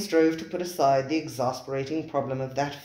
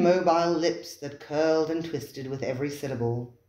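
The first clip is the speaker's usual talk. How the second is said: usual speaking voice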